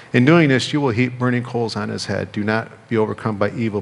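ordinary delivery

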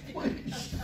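a small whispered please. Retold or imitated. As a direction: beg quietly so dad won't hear. Kid begging mom.

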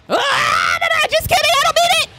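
high panicked yelp, backing off fast; panicked shriek. Real, not acted. Streamer shrieking at the killer, high and panicked. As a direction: shriek your take-back as the killer swings.

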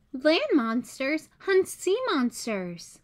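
usual speaking voice

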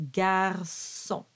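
"Garçon" with ç as a clear S; soft C. Shown as a demonstration. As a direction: split "Garçon" before a soft S sound. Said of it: "soft C pronounced like an S".

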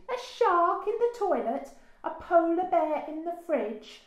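high, loud, incredulous teasing; hooting mockery. A narrator's reading. Parents make fun of Daisy, hooting. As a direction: hoot each absurd idea aloud. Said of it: "they hooted".

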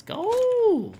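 high excited shout of go; pumped up, let it fly. Real, not acted. Hype exclamation after sniper find.

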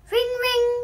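high, loud ringing; alarm clock ring. A narrator's reading. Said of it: "went Pig's alarm clock".

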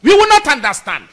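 loud, high defiant declaration; indignant warning. Real, not acted. Supporter refusing to accept betrayal, loud and indignant. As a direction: hurl it out as a warning.

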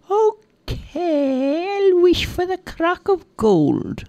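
very high, drawn-out character voice; high-pitched, gleeful wish-maker; Peggy, eager wish. Acted. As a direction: stretch the okay, way up high. Peggy's voice making her wish.

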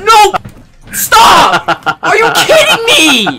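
loud disbelieving shout, laughing; shocked shout. Real, not acted. Shocked by a big donation.

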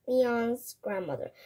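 ordinary delivery

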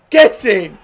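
loud high yell; celebratory shout. Real, not acted. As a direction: roar it out for the goal. Celebrating a goal.

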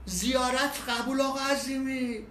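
slow drawn-out shouted blessing; loud call. Retold or imitated. Man at the gas station calling a blessing to him, loud drawn-out call. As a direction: call it out so everyone hears. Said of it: "یه نفر گفتش که".

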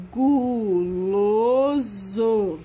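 slow, loud, drawn-out reading; syllable reading. Shown as a demonstration. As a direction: stretch "Guloso." syllable by syllable. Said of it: "Vamos à leitura".

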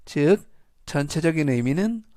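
ordinary talk, nothing marked

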